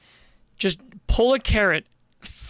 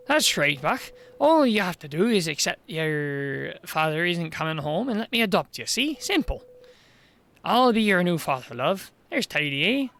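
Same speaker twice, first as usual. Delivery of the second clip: Welsh mayor voice. Acted.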